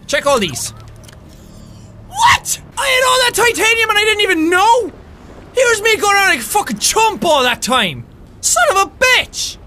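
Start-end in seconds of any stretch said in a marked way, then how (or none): 2.1-9.6 high, wailing disbelief; exasperated outburst. Real, not acted. Gamer finds titanium had been there all along, exasperated. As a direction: cry out in indignant frustration.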